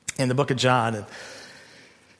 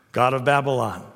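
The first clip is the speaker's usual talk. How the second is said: normal talking voice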